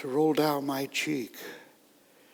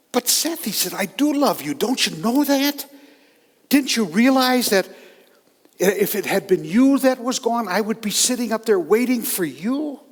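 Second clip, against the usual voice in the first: a lightly voiced father, louder and higher, urging his love; stunned father pleading; 6 semitones higher, 6 dB louder than usual. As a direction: insist on your love to the angry son. Father answers the son's outburst.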